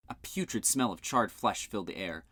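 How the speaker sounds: plain reading voice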